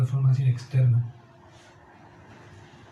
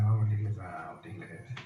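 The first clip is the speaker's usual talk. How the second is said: a parent's call, trailing off to nothing; parent calling to church; 8 semitones lower, 5 dB quieter, faster than usual. Retold or imitated. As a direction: lower voice, fading to a murmur. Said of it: "tenía que ir ahí".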